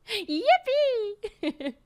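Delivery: high, loud Yippee shrieked with glee; gleeful cheer. Real, not acted. Cheering on a chatter's good news, a high gleeful squeal. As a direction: throw your arms up and squeal it.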